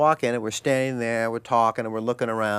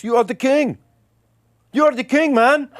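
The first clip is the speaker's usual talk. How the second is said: high, loud put-on voice of an adoring stranger; high, loud impression of a drunk Dutch fan hailing a celebrity; imitating a fan; 13 semitones higher, 7 dB louder than usual. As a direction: gush it like a drunk starstruck fan. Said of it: "he goes".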